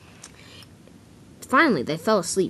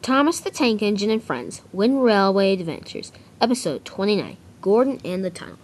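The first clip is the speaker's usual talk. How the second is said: announcing the episode title, a bit louder; title announcement; 3 semitones higher, 4 dB louder than usual. Acted. Young narrator opening his fan episode, announcer read. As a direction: read the title like a show opener.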